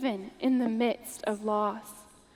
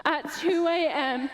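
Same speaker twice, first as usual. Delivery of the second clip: usual speaking voice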